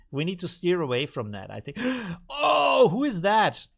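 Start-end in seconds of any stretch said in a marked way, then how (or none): 1.8-3.7 high, loud "oh" of surprise; gasp, then exclaim at a sudden sighting; surprised gasp. Real, not acted. Streamer spots a Sonic character on screen, surprised.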